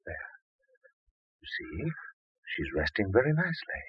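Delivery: doctor hushing the husband at bedside; hushed. Acted. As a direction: keep it down near the sleeper. Doctor hushes by sleeping patient.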